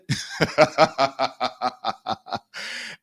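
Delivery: long burst of laughter; real, drawn-out cackling laugh. Real, not acted. Delighted at the upgrade offer, long laugh.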